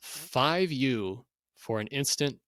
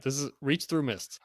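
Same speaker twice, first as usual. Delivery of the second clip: usual speaking voice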